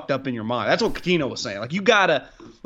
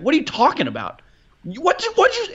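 mock disbelief; 12 semitones higher than usual. Star player baiting a rival on court, with mock disbelief. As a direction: pitch leaps high in fake disbelief. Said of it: "get you to react like".

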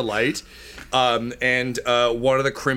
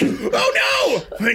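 very high cartoon shout; shrill-pitched mock panic; panicked creature. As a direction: squeal in comic alarm like a little minion. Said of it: "Impocrimpas are like".

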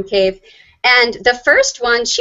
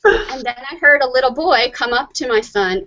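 ordinary talk, nothing marked

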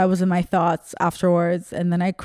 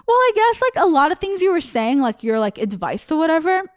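put-on airy high girl voice, dismissive; condescending fan; 8 semitones higher than usual. Comedian mocking the fan's dismissive feedback in a high airy voice. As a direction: mimic her, pitched up and breezy.

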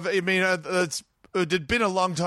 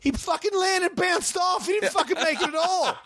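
indignant shout. Real, not acted. Arguing about a backflip.

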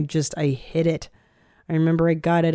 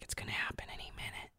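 fast, hushed whisper; 23 dB quieter, faster than usual. Retold or imitated. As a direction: whisper it like a nervous secret. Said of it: "'cause you're like".

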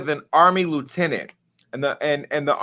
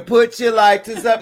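mock hype call; 6 semitones higher, 4 dB louder than usual. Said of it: "She got [name]".

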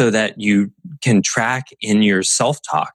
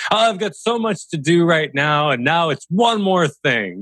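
frustrated victim voice; 9 semitones higher than usual. Retold or imitated. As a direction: whine it like life is against you. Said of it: "the angry, frustrated victim ... life voice".

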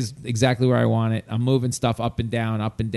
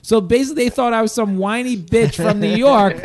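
higher and louder, indignant and fired up; outraged rant; 8 semitones higher, 5 dB louder than usual. Real, not acted. Angry about friends getting his old room, loud indignant rant. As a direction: vent the injustice at full steam. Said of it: "I was so fucking pissed".

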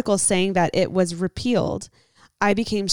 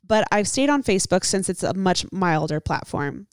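usual speaking voice

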